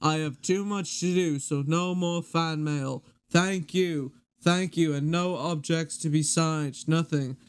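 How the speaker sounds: slow, measured drummer impression; deliberate, drawn-out solemn address; memorised video recital. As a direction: deliver it as a ponderous public announcement. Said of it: "I've memorized this".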